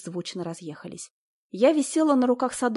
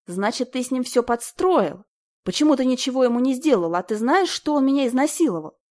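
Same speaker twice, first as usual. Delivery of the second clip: loud, higher accusing shout; angry shouting; 5 semitones higher, 6 dB louder than usual. A narrator's reading. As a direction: yell the accusation at him. Wife confronts husband, shouting.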